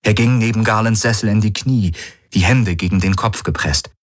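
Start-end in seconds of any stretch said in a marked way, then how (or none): none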